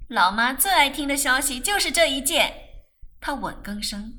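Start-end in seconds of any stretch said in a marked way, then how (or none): 0.1-2.5 loud, eager delight; overjoyed. A narrator's reading. Rose talking of Mum's favourite news, overjoyed. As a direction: gush it out loudly.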